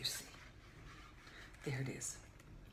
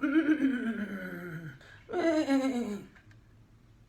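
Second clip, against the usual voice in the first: horse whinny; 3 semitones higher, 10 dB louder than usual. Acted. Blue horse appears in book.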